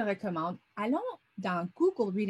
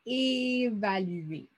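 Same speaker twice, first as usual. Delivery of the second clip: "Évaluer" stretched very slowly so each sound is heard; slow it right down for listening; drawn-out word; much slower than usual. Shown as a demonstration. Teacher stretching the word so pupils hear the first sound. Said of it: "le premier son que tu entends dans le mot".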